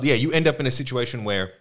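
ordinary talk

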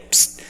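a quick hissed psst; soft hiss cue. Retold or imitated. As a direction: catch the family's attention quietly. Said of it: "and I can go".

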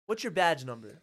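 loud, level challenge to an officer; firm demand. Retold or imitated. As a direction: demand it firmly, without extra punch. First demand to cop, acted.

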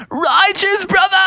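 high shouted surfer cheer; excited cheer. Acted. Iggy cheering Froggy on, high shouted cheer. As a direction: whoop it out like a stoked surfer.

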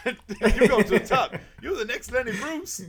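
high, loud, laughing hype; drawn-out, high, hammed-up praise; mock gushing praise. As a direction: act helpless with laughter while hailing a comic.

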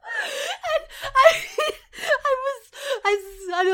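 high, breaking through laughter; laughing hard. Real, not acted. Mother recalling her reaction while crying with laughter. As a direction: barely get it out for laughing. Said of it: "I started laughing so hard".

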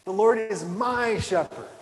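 MY stretched and lifted, slow line; stress on MY. Shown as a demonstration. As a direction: make the word my stand out. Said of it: "Emphasize every word of just that first line".

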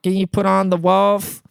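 high, far-from-natural dopey voice; mimicking the customer. Coworkers parodying the customer's radio request, stupid mocking voice. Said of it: "we started mimicking him".

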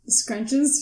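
ordinary talk, nothing marked

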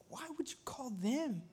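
whispered scoffing question; scornful whisper. Retold or imitated. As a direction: hiss the doubt like a gossip. Voicing the scornful crowd.

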